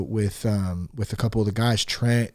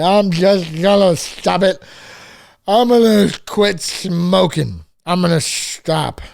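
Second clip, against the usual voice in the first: put-on higher, louder, slow slurred drunk promises; 6 semitones higher, 5 dB louder, slower than usual. Host mimicking his drunk past self to listeners, slow slurred drunk voice. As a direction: play your own drunk self swearing to quit. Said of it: "I'm doing drunk, drunk [name] voice".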